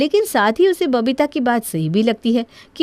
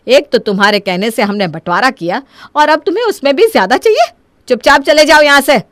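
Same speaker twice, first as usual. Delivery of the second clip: indignant rebuke, rising to louder order; angry dismissal; 3 semitones higher, 4 dB louder than usual. Acted. Mother refusing son a bigger share, angry dismissal. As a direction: throw the greedy son out.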